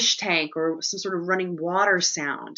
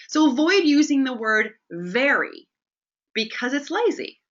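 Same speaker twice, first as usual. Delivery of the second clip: higher, louder lecturing quote; quoting the teacher; 6 semitones higher, 4 dB louder than usual. Retold or imitated. A hint of the film teacher scolding the class, higher. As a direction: lightly play the passionate teacher. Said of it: "he says specifically".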